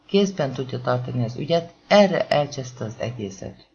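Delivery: ordinary delivery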